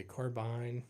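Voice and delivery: slow "carbine" ending like mine; carbine as car-bine. Shown as a demonstration. Streamer reads chat's vote, carbine as car-bine. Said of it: "Uh, bine".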